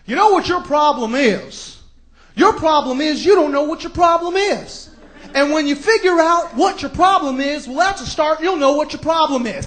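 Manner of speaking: mocking TV advice guru impression; hammed-up impression of a TV advice host; Doctor Phil. Comic imitating a TV advice host lecturing, mocking impression. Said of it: "Doctor Phil".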